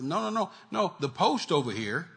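usual speaking voice

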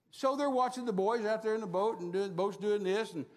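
ordinary talk

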